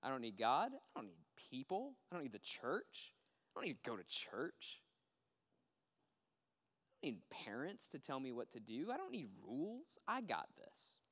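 boastful heart. Retold or imitated. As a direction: brush everyone off like you know it all.

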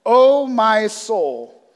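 loud, very slow proclaimed verse; solemn proclamation. Retold or imitated. David calls on his soul, slow and loud. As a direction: stretch out the verse with longing. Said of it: "He says".

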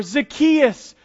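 ordinary delivery

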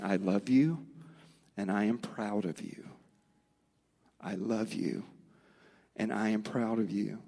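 tenderly, just above a whisper; tender father's blessing. Retold or imitated. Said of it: "hear my voice in their heart saying".

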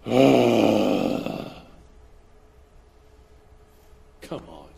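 low, exasperated groan then letdown; imagined God sighing in disappointment; disappointed God. Retold or imitated. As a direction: heave a fed-up sigh at the fall. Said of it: "God's gonna go".

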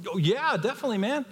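high, bright yes to the waiter; eager agreement. Retold or imitated. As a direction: gush a happy yes. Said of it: "I'm like".

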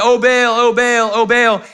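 hammed-up pleading call to an absent god; mock crying out. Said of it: "they start crying out".

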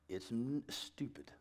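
whispered under the breath; hushed. Acted. Father judging his kids' complaints, hushed under the breath. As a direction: slip it out in a whisper. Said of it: "I said sh-, stupid".

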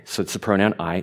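usual speaking voice

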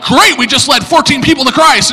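high, loud mock enthusiasm; gleeful boasting. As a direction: play the triumphant friend, loud and high. Friends boast to the dejected speaker.

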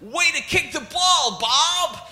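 high, loud cheer from the sideline; parent cheering. Retold or imitated. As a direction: shout encouragement at a six-year-old. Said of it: "parents going".

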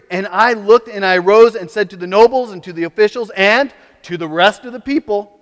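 loud, high preached scripture reading; preaching at full force. Acted. Preacher reading Nehemiah to the congregation, at full force. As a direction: proclaim it to the whole congregation.

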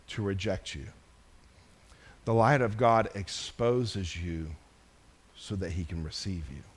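low, soft sermon appeal with long pauses; quiet, low-pitched pastoral appeal; hushed preaching. Real, not acted. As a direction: bring it right down and let each phrase settle. Preacher drops to tender, hushed appeal.

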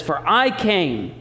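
"For I came" very slow, each word weighted; stated boldly. Shown as a demonstration. Pastor declaring Jesus' mission to the church, slow and bold. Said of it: "state it boldly for you".

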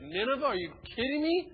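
mock protest at being sent; incredulous Jonah. Jonah balks at God's order, incredulous. Jonah voiced in retold story.

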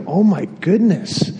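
slow, wondering oh my goodness; a touch of childlike wonder; awed. Retold or imitated. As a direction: marvel at it like a child. Child awed by his vision.

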